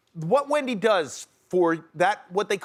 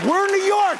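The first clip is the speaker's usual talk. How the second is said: louder and higher, a mock exasperated yell; exasperated shout; 11 semitones higher, 6 dB louder than usual. Dad yelling at his daughter on the subway, mock exasperated. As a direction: throw it out like a fed-up dad. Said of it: "And I was like".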